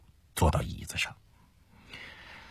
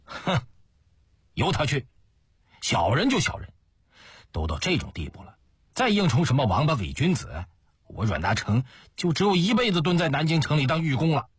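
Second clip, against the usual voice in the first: higher-pitched defiant outburst; 4 semitones higher than usual. Acted. Schemer shrugs off being thought a petty villain, defiant. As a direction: scoff and protest, pitch climbing.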